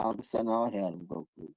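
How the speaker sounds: usual speaking voice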